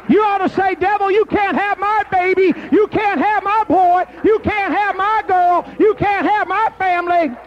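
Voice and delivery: high, loud, ringing preacher's cry, slowing at the end; roaring revival rebuke; full-force preaching. Acted. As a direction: rebuke the devil at the top of your voice.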